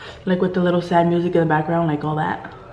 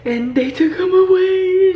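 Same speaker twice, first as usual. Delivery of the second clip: much higher, slow, mock tearful lament; imitating melodramatic wail; 9 semitones higher than usual. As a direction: ham it up like a weepy movie heroine. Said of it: "she even changed her voice".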